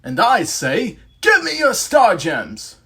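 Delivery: loud, high, slow British knight villain; British accent villain. Acted. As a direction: boom it out as a British-accented medieval monster. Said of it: "speaks in a British accent".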